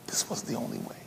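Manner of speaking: tender whisper; whispered comfort. Acted. Jesus telling Calvin gently, whispered tender confession. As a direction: breathe it gently.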